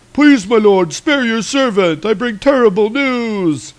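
high, hammed-up frightened servant voice; pleading servant. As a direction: beg your lord to spare you. Servant pleading while bringing bad news.

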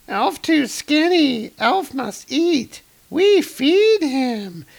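slow, very high squeaky fairy. Acted. Fairies deciding to feed dark elf, high slow fairy voice. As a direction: squeak it slowly, like a tiny creature.

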